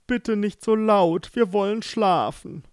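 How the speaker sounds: yawning. Acted. Daughter Greta waking, yawning at her father, sleepy. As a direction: stretch and yawn it out. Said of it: "sagte nur gähnend".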